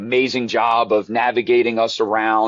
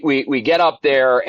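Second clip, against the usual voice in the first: normal talking voice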